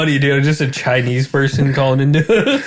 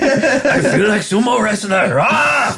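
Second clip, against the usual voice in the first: mock broken English; 6 semitones higher, slower than usual.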